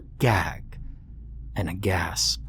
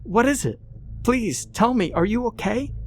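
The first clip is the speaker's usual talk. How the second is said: high, desperate frightened questions; begging him to answer; anxious girl's pleading voice; 13 semitones higher than usual. A narrator's reading. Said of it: "she pleaded".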